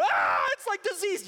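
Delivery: loud, high disgusted cry; horrified Moses. Acted. Moses crying out at his diseased hand, loud and high. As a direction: recoil in horror. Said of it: "pull it out".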